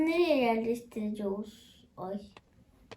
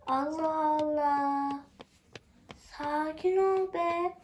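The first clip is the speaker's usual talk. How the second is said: exasperated soothing; 3 semitones higher, much slower than usual. Acted. Calming a panicking game character.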